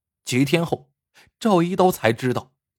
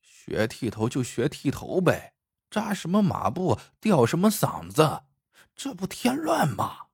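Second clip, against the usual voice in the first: quiet, grumbling undertone; 小声嘟囔; 2 semitones higher, 6 dB quieter than usual. A narrator's reading. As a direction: grumble softly under your breath. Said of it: "忍不住小声嘟囔". (压低音量小声抱怨)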